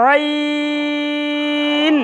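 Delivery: আইন with the আ stretched very long, four to six counts; long drawn vowel. Shown as a demonstration. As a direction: hold the আ of আইন long from mid-throat. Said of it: "ইটা লম্বা". (আইন এর আ টেনে লম্বা করে বলা)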